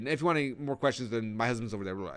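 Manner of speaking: imitated jittery sign-off, trailing into babble; nervous mumbled pitch. Nervous presenter sending guests to her husband, mock mumbled brush-off. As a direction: hurry through it and fade into blah-blah. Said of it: "she starts mumbling".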